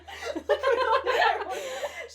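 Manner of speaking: shrieking laugh, high and loud; real high laugh at the memory; high burst of laughter. Real, not acted. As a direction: let a squealing laugh burst out. Friends laughing at story.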